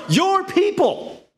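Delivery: "your people" stretched in high, pointed emphasis; pointed disowning. Retold or imitated. God disowning the people to Moses.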